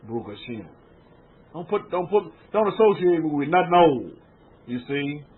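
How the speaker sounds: usual speaking voice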